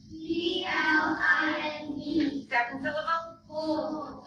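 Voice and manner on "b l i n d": slow, spaced-out spelling of "B L I N D"; letter by letter. Shown as a demonstration. Teacher spelling the first syllable aloud with students, slow. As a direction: lay out each letter slowly for the class. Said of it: "Okay, spell it".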